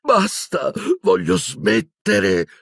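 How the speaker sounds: high, slow plaintive whine; moaning. A narrator's reading. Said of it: "si lamentò Silente".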